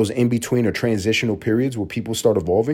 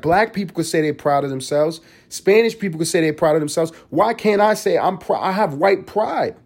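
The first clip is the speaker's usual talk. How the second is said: higher, preening mock girl voice, mildly hammed; mock self-proud TikTok girl; 6 semitones higher than usual. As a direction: parody her showing off her looks. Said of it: "She's like".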